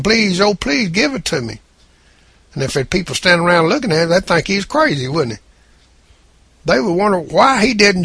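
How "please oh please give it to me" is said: higher-pitched pretend pleading; imitating someone pleading, with little real anguish; mock begging. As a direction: lightly act out begging for it. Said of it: "he just started crying and begging".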